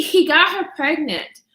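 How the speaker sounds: normal talking voice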